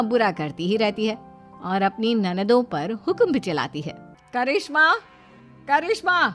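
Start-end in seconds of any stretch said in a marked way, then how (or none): 4.3-6.3 higher, lightly voiced bossy sister-in-law; calling out. Sister-in-law summoning Karishma to the kitchen, calling out. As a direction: call for her impatiently.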